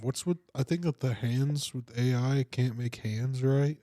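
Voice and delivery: usual speaking voice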